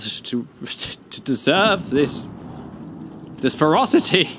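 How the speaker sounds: giggling at the mock outrage over a pom; laughing. Real, not acted. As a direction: laugh it out in disbelief. Laughing at British jokes.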